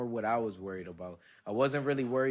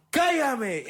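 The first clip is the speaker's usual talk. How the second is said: much higher, louder dare; Hulk rage; 12 semitones higher, 7 dB louder than usual. Retold or imitated. Daring her to shut him up, loud Hulk rage. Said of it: "I turned into the Hulk".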